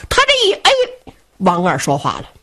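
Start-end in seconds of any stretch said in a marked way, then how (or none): none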